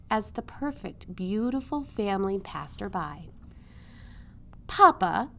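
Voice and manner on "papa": louder, calling to father; calling to papa. A narrator's reading. Princess calling to the king on horseback. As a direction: call out for her papa. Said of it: "called the princess to the king".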